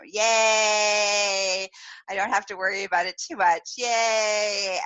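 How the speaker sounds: long drawn-out yay; relieved cheer. Retold or imitated. Replays relief at open salon.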